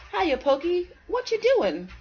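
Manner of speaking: put-on young squirrel calling to a friend; high kid greeting. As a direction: bounce in like an eager little kid.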